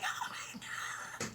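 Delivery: voiceless, breath-only mock freak-out; going crazy. Reacting to the job offer email, hushed freak-out. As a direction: act out losing it, without full voice. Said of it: "just going crazy".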